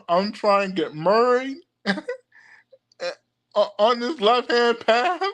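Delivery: fake Saint Louis accent. Shown as a demonstration. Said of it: "my fake Saint Louis, Missouri accent".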